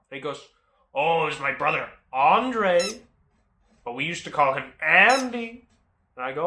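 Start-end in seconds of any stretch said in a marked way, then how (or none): 0.9-5.7 put-on Maine accent. Seller explaining the car was his brother's, Maine accent. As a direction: punch the names in broad Maine speech. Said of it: "drop the accent here real quick".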